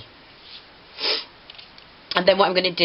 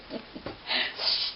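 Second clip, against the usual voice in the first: breathy giggle; 11 dB quieter than usual. Real, not acted. Giggle fit continues.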